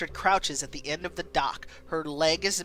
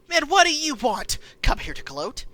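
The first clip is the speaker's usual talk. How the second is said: loud, higher bitter accusation; furious; 5 semitones higher, 5 dB louder than usual. Acted. Mother accusing Dwight, furious and bitter. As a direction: spit the accusation. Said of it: "Her expression twists with fury".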